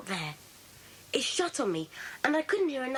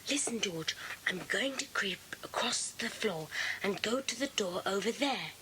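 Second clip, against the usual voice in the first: whisper; 5 semitones lower, 5 dB quieter than usual. Acted. Boys creeping up, spying.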